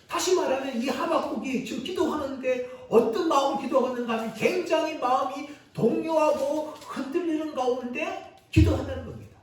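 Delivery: normal talking voice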